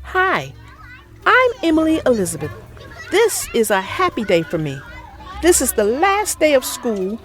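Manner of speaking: put-on lively storybook narration for kids; reading to children. A narrator's reading. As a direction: read it like bedtime story time. Reads picture book to children.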